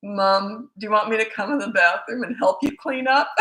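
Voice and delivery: ordinary talk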